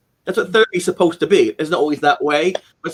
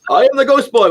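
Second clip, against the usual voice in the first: higher, louder mock announcement; playful wrestling shout; 7 semitones higher, 6 dB louder than usual. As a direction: boast it like a kid grappling a friend. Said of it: "I'd wrestle and say".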